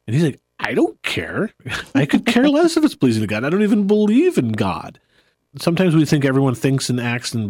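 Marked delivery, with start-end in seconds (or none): 0.6-5.0 mock-indignant brush-off, pitch leaping high; imitating someone who couldn't care less; acted dismissive retort. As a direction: throw it back, loud and high, as a scoffer. Retelling an unbeliever's brush-off.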